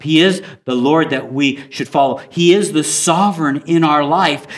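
normal talking voice

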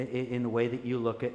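normal talking voice

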